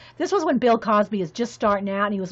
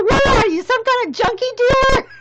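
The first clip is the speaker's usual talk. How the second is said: much higher, louder comic indignation; mock outrage; 13 semitones higher, 7 dB louder than usual. Customer refused more sunburst pens, mock outraged. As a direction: belt out a hammed-up accusation. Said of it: "that's what I felt like when Staples told me".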